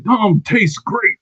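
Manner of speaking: slow, high drawn-out imitation; mock quote. Host mimics Wade Barrett to his co-host. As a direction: stretch it out, mocking Wade Barrett. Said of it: "He said".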